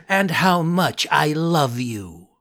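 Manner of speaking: slow, tender little puppet voice; a lightly voiced grateful boy; Pinocchio voice. Pinocchio's voice in story.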